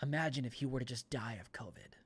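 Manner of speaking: sly whispered insinuation; insinuating whisper. Retold or imitated. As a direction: hush it like a dark suggestion.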